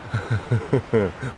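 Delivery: quiet amused chuckle; soft laugh. Acted. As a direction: laugh under the breath. Man laughs after the fight.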